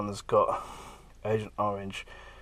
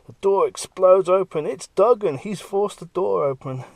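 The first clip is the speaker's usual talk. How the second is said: dramatic excitement; 9 semitones higher, 10 dB louder than usual. A narrator's reading. Dramatic burst into cell.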